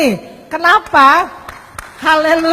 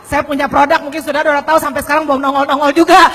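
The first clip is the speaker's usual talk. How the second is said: ordinary delivery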